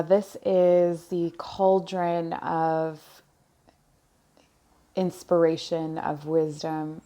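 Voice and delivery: very slow, long pauses between phrases; slow, halting. Real, not acted. Reader receiving messages as she shares them, slow and halting. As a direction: speak slowly, as if each piece is still arriving. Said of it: "I'm speaking slowly because messages are coming in".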